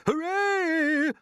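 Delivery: high, loud triumphant cheer; shouted cheer. A narrator's reading. Grasshopper cheering as the seagull is caught. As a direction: whoop with joy. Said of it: "shouted the old green grasshopper".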